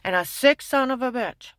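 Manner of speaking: indignant loud repeat. Real, not acted. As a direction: shout the insult again with force. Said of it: "You talk about".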